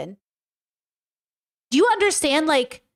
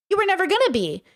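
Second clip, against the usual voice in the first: repeated higher for emphasis; pitched-up insistent repeat; emphatic higher repeat; 6 semitones higher than usual. Real, not acted. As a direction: say it again, pushing it up.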